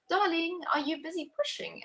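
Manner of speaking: high-pitched imitation of a midwife; imitating midwife. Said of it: "she came in and she's like".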